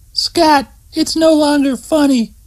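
high woman's voice, fed up and scolding; annoyed Terry. Acted. Terry tells Scott the prank stopped being funny, high exasperated voice. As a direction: tell him the joke is over.

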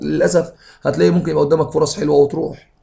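normal talking voice

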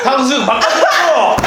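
high, loud angry yell as the customer; angry shout. Pretend. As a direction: shout the demand at the restaurant. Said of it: "그 전화를 한번 해볼게요".

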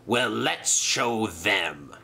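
low, slow villain's menace; growled threat. Acted. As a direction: draw out the threat darkly. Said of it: "he growled".

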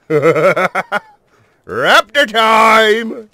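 high, loud laughing cry; gleeful announcement. Real, not acted. Gamer hyping viewers for the raptor tame, loud and high. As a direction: crow it like a battle call.

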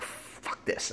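whispered, fed up; muttered whisper. Retold or imitated. Fed-up guy gives up, whispered curse. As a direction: breathe it out under your breath. Giving up on waiting.

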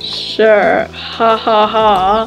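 low, deadpan fake laugh; sarcastic. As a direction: humour them with an unamused ha-ha-ha. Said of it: "that was just sarcasm".

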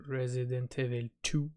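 put-on "Resident Evil two" in a deliberately lame English accent, soft and slow; cheesy fake English accent announcing "Resident Evil two"; bad English accent. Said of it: "avec un petit accent anglais nul".

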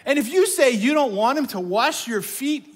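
loud and high, pressing the point; emphatic preaching. Real, not acted. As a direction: hammer it home to the crowd. Preacher keeps full force.

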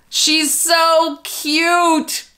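high, slow coo at the doll. Real, not acted. To the doll she adores, a high slow coo. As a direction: melt over the doll, drawn out.

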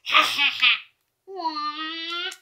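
drawn-out mock villain cackle; slow evil laugh. Gamer taunting his rival, slow mock evil laugh. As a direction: laugh slowly, like a cartoon villain.

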